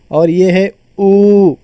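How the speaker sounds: ऊ held very long and slow; long vowel demo. Shown as a demonstration. Teacher holding ऊ long to show a long vowel. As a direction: draw ऊ out long. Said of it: "दीर्घ स्वर है".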